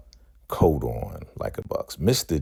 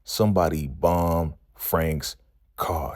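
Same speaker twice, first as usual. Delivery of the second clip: ordinary delivery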